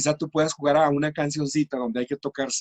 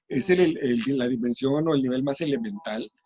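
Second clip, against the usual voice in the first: ordinary delivery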